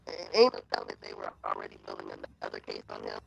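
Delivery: choppy robotic glitching audio. Real, not acted. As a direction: voice breaking up like a robot. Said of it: "You sound like a robot".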